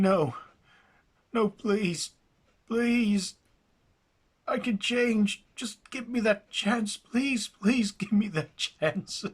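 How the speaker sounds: high, loud, crying pleas; tearful begging. Acted. Jackson begging the skeleton spirit, crying. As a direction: beg through tears for a second chance. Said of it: "As he starts to cry".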